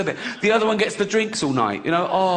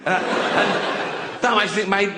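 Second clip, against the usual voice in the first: ordinary talk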